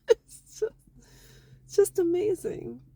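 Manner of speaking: choked up, crying through the words; tearful, overcome. Real, not acted. Wife moved to tears praising her husband, voice breaking. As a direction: voice breaking up high with tears of joy. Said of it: "Sorry, I get emotional".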